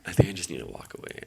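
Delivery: loud and rushed, fast giving up; fed-up outburst. Real, not acted. Trader venting at his losing trade, loud and fast. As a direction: blurt it out and quit. Frustrated trader after laugh.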